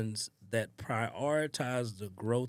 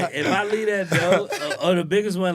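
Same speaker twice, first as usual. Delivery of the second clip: laughing while talking; 8 semitones higher, 12 dB louder than usual. Real, not acted. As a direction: laugh your way through the line. Laughing over door story.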